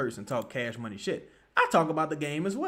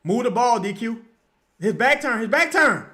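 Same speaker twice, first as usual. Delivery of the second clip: normal talking voice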